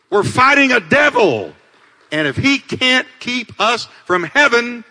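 loud, high, driving sermon delivery; fired-up pulpit proclamation; impassioned preaching. Real, not acted. Preaches at full force.

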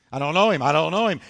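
loud, emphatic protest; insistent denial. Retold or imitated. Peter denying Jesus under questioning, insistent. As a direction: fire it out loudly, leaning on KNOW.

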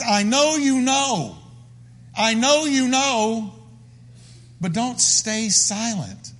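high, slow, drawn-out prayer; impassioned plea. Acted. David begging the Lord not to stay silent, slow impassioned plea.

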